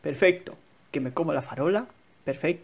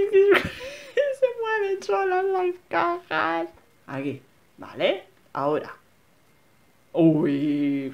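usual speaking voice

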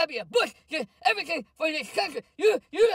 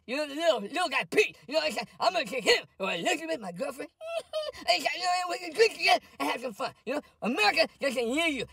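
ordinary talk

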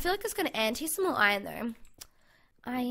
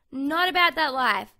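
loud catchphrase; 9 dB louder than usual. Real, not acted. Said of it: "I keep saying that".

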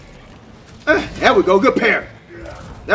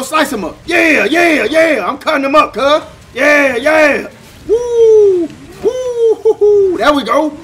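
loud, high whoops of triumph; hyped fight cheer; 8 semitones higher, 6 dB louder, slower than usual. Real, not acted. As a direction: cheer yourself on mid-combat. Hyped while fighting in game.

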